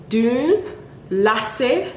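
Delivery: normal talking voice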